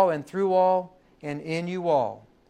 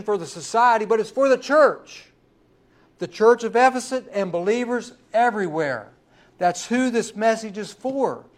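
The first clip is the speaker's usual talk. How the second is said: loud, rising preacher's build; fervent pulpit preaching, much louder and higher; preaching at full force; 5 semitones higher, 5 dB louder than usual. Acted. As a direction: preach it out to the congregation.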